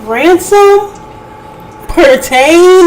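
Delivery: high disbelieving "ransom?"; incredulous. Real, not acted. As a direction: repeat the word like you can't believe it. Mocking the misused word.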